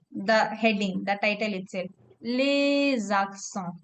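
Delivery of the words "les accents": les accents drawn out slowly as "lay-zaksawn"; slow teacher's demo of "les accents" with a z link; French liaison. Shown as a demonstration. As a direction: stretch les accents, linking the s as a z. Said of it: "the sound".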